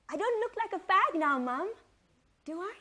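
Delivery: put-on high, eager little boy; child voice. Son showing his mother his shaved head, high and eager.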